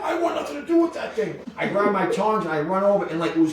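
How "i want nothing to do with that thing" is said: high, whiny refusal; backing away in fear; scared buddy. Retold or imitated. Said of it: "He's like".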